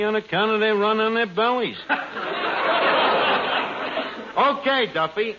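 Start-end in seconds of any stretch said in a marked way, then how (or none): 1.8-4.3 airy chuckle; breathy laugh. Real, not acted. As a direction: laugh under the breath at the joke. Laughs at own joke.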